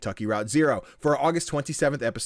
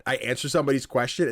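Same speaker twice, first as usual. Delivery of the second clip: ordinary delivery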